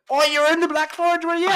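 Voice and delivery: mocking McGregor losing it, high and loud; McGregor impression. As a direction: ham up an angry fighter's menace. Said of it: "he's like".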